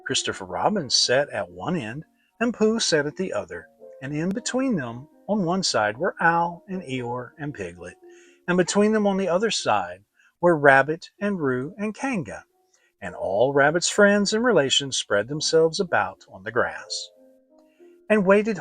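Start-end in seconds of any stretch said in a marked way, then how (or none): none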